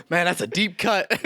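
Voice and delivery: laughing through the words. Real, not acted. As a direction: chuckle while admitting it. Embarrassed laughing reply.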